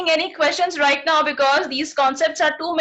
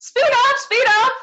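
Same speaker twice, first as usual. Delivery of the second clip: high, bright hurry-up call; urging to hurry; 9 semitones higher, much slower than usual. Real, not acted. Teacher urging her pupils to write faster, high. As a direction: chivvy the class along. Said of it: "urging children to hurry".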